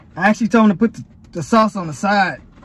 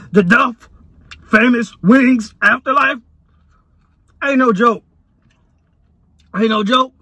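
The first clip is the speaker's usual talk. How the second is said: loud; 3 semitones higher, 9 dB louder than usual. Real, not acted. Loud reaction after the burn.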